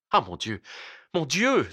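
sighed lament rising sharply on the second cry; tearful sigh. A narrator's reading. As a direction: lament it, close to tears. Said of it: "soupirait le docteur, les yeux brouillés par les larmes". (soupir plaintif, au bord des larmes)

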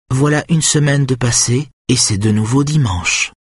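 solemn woman opening a ritual, voiced high; solemn Lydia. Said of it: "déclare-t-elle solennellement".

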